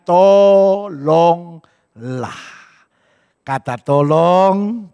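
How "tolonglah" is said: very slow, drawn-out Tolonglah; slow polite please. Shown as a demonstration. Grandpa opening his request, very slow.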